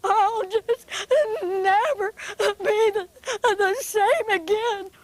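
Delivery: high, teary puppet bunny voice; crying bunny. Acted. Betty Bunny weeps to Buster Bear, high and crying. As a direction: wail it like a heartbroken little bunny.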